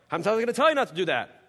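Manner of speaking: a touch of a parent losing patience; exasperated parent. Shown as a demonstration. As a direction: rattle it off like a fed-up parent. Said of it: "not so much the exact order".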